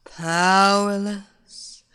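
slow, sultry villainess luring her victim; seductive hypnotist. Acted. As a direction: purr it like a temptress casting a spell.

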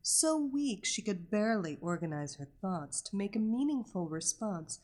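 usual speaking voice

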